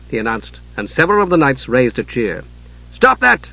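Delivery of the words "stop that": angry shout, much higher and louder; angry order. Acted. Sub-commander silencing cheering knights, angry shout. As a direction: cut the cheering dead. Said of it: "he ordered angrily".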